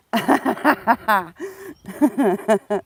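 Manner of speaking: loud burst of laughter, tailing off; hearty laugh. Real, not acted. Vlogger cracks up at own remark, hearty laugh. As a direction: laugh out loud in a long run.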